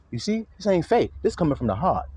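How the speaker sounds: normal talking voice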